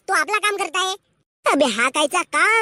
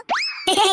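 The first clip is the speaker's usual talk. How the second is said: short amused laugh. Acted. Friend mocking the dog-and-lover comparison, laughing. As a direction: laugh at a friend's silly claim.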